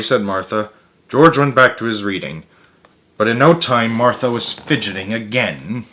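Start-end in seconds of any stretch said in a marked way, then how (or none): none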